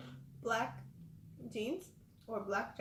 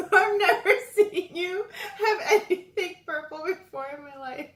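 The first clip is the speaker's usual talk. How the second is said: laughing loudly in disbelief; high, loud laughter through the words; 7 semitones higher, 10 dB louder than usual. Real, not acted. Laughing at friend's purple claim.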